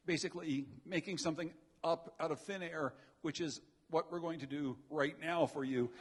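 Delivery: normal talking voice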